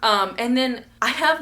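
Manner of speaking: normal talking voice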